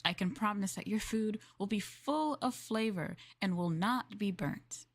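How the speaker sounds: ordinary delivery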